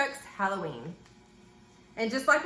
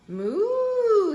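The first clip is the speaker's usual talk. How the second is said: long, high swooping moo; cow moo; 8 semitones higher, 4 dB louder than usual. A narrator's reading. Cow answering from under the mask, long swelling moo. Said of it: "says the cow".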